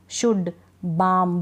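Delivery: शुड and बाम, both without an L; slow model words शुड, बाम; silent L. Shown as a demonstration. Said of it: "बाल्म नहीं पढ़ेंगे".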